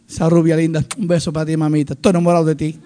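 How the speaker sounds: low, soft sweet talk; affectionate aside. Real, not acted. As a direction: drop down tenderly to flirt with your wife. Sweet talk aside to his wife.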